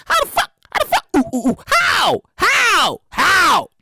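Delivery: high, exasperated how after how; mock incredulity. Podcaster puzzling over a supposed cousin, incredulous.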